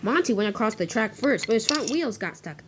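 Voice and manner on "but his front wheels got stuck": usual speaking voice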